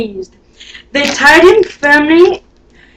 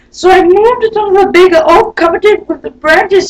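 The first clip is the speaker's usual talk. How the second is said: leprechaun voice; 3 semitones higher, 3 dB louder than usual. Acted. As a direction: wail out the excuse like a wee man. Leprechaun excuse, high cry.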